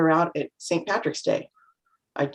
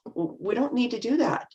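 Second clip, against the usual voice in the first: ordinary delivery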